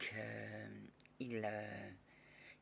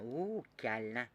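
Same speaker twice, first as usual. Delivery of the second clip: ordinary talk, nothing marked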